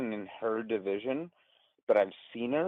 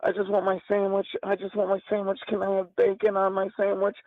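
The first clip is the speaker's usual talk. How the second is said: panicked whimper; 11 semitones higher than usual. Retold or imitated. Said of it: "I legitimately was so terrified".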